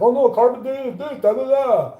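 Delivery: high, loud mocking voice of know-it-all scientists. Ranting vlogger mocks scientists with a high sneering voice. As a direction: sneer it out like a smug expert. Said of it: "they're like".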